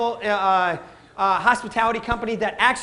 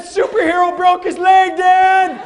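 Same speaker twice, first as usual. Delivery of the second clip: put-on whining kid; 8 semitones higher, 9 dB louder, slower than usual. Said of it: "Instead of".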